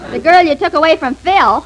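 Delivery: ordinary talk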